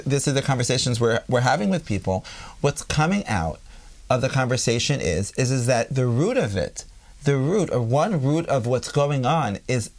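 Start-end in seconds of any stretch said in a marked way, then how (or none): none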